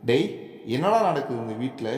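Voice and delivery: plain reading voice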